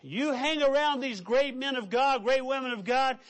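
fervent sermon climax; preaching at full force. Acted. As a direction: drive the point home to the congregation.

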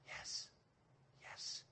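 breathy timid whisper; imitated whisper. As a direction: whisper it shyly like a nervous boy. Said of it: "That's how he spoke".

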